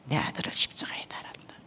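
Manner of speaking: faint, low whisper; whispered voice of God. Retold or imitated. God revealing the cross to the wife, whispered. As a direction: breathe it out, barely audible. God tells of His Son.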